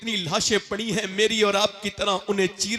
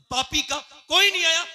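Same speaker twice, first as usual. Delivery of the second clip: indignant cry; 9 semitones higher, 5 dB louder than usual. Real, not acted. Indignant rising outcry.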